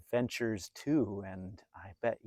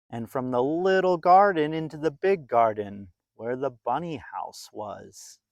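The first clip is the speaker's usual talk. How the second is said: put-on lively read-aloud voice for a children's story; storybook narration; 8 semitones higher, 12 dB louder than usual. A narrator's reading. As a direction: tell it like a bedtime picture book.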